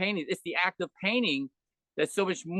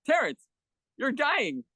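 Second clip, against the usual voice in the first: blunt earnest address; 7 semitones higher, slower than usual. Retold or imitated. Speaker telling dying friend Terrence he is dying, earnest higher call. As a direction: call out to him plainly and openly.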